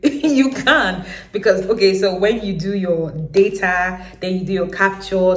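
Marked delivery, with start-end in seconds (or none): 0.0-1.1 laughing. Retold or imitated. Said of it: "they were laughing like".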